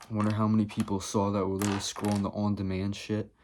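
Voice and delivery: usual speaking voice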